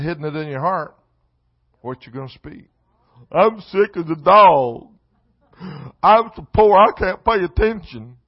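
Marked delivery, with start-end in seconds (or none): 3.3-8.2 loud, high mock-whiny complaining; griping churchgoer. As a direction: ham up a moaning complainer, ending in a high shout. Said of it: "That's what people talk about".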